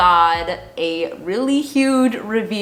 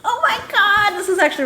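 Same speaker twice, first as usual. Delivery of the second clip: excited; 13 semitones higher, faster than usual. Real, not acted. Unboxing exciting package.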